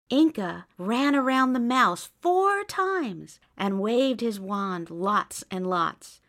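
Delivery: ordinary delivery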